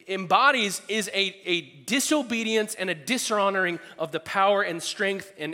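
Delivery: normal talking voice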